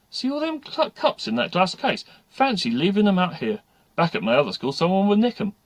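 high, loud know-all pupil; cheeky schoolgirl. Acted. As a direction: marvel cheekily at the silverware. Eileen, cheeky pupil, admires cups.

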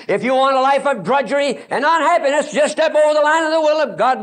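high, driving preacher's cry hammering each word; fiery preaching. Acted. Evangelist warning camp kids, preaching at full force. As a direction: preach it hard and rising, pounding the repeats.

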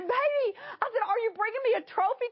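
high-pitched, gushing excitement; eager, elated, pitch way up; beside herself, thrilled. Retold or imitated. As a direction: squeal it like a re-enacted thrilled phone call. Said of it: "I was just beside myself".

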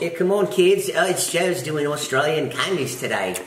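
high, drawn-out Aussie dad voice; Outback dad. Acted. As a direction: call the kids over like an Australian dad. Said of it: "Is that some dad in the Outback?".

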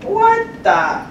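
high, loud startled cry; shocked. Retold or imitated. Tech startled by the talking computer, loud shocked blurt. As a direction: blurt it out in sudden disbelief.

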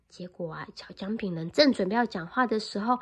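plain reading voice

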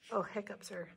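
usual speaking voice

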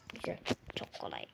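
whisper. Real, not acted. Repeated whisper.

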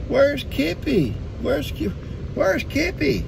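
high, slow, searching voice; imitating G. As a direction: mimic his wife looking for the dog. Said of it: "G says".

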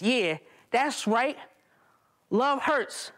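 slow, high taunting shooter; gloating gunman. Retold or imitated. Gunman standing over the narrator, slow high gloating taunt. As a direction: gloat over the fallen body.

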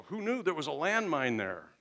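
high, loud mock astonishment; hammed-up disbelief; startled outburst. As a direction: exclaim as if blindsided by a quarrel. Acted spouse startled by argument.

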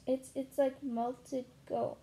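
ordinary delivery

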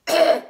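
loud, high cry of pain; pained yelp. Real, not acted. Burning mouth from the spicy food, loud pained yelp. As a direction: blurt it out as the heat hits.

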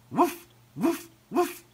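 three quick, high woofs; repeated dog barks. A narrator's reading. Ebb getting Flo and Mom to follow, barking. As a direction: bark insistently like a dog. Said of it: "barked Ebb until Flo and Mom followed".

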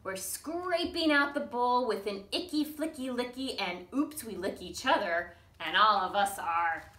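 lively, sing-song storybook reading; reading to children. Acted. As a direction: read it out bouncy for little ones. Reads picture book to toddlers.

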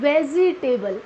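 vegetable as "ve-jee-tay-bul"; wrong pronunciation. Shown as a demonstration. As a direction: add a clear "jee" in vegetable. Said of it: "Not vegetable". (vegetable को "वेजीटेबल" बोलें)

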